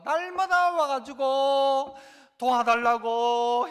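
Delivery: loud, long-drawn wail of a nagging plea; insistent pleading. Retold or imitated. Widow begging the judge daily, loud insistent plea. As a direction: drag it out loudly, imitating persistent begging.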